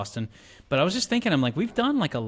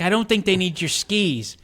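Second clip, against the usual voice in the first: loud, high, slow objection; acted movie dad; 8 semitones higher, 7 dB louder, slower than usual. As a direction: play a dad exasperated with his daughter.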